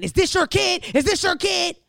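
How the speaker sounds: high, loud outraged neighbor demanding an answer; angry demand. Retold or imitated. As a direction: bark it like an irate neighbor. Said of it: "angry, saying".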